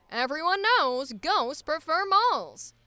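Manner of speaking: brisk transatlantic newsreel announcer; 1950s corporate safety film narrator; old-timey announcer. Acted. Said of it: "the weird old-timey voices".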